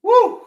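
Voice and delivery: short, high, loud "woo"; celebratory whoop. Real, not acted. Announcer celebrates the extra point, quick high whoop. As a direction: pop out a quick cheer.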